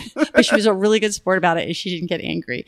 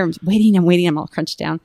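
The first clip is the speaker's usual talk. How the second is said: ordinary delivery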